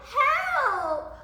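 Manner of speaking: long, high, loud scream; hammed-up storybook panic; frightened cry. A narrator's reading. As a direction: shriek for rescue. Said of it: "the other one cried".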